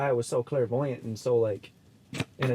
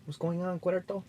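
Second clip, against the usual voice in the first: pitched-up Spanish accent; 7 semitones higher, 5 dB quieter than usual. Shown as a demonstration.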